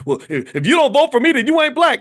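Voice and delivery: loud, high mock outburst; sudden retold angry yell; blowing up. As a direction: imitate a man losing his temper, shouting. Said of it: "that's when he blew up and said".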